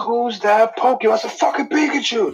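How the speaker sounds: very high-pitched Pokemon quiz parody; high, silly cartoon voice; cartoon Pokemon announcer. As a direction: squeak it like a cartoon catchphrase. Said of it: "Mittens sound like a fucking Pokemon".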